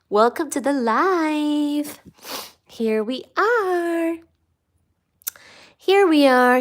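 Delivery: high, slow sing-song greeting; high drawn-out welcome. Real, not acted. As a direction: lilt it up high and slow for the viewers.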